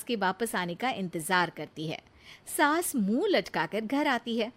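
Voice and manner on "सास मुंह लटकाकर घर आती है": ordinary delivery, nothing marked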